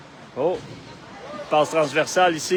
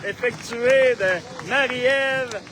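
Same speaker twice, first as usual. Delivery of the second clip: long goal yell; 9 semitones higher, much slower than usual. Real, not acted. Goal scored, commentator erupts.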